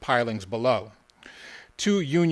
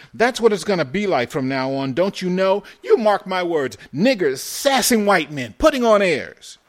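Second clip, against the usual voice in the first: high, loud outraged ranting; indignant; 8 semitones higher, 8 dB louder than usual. A narrator's reading. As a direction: rant at your friend, voice raised in indignation. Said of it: "Jakey's voice had risen yet higher in indignation".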